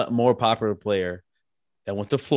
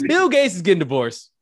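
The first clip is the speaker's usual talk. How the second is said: higher and louder, a big reveal; excited announcement; 8 semitones higher, 6 dB louder than usual. Real, not acted. Podcast host springing the news on his cohost, excited.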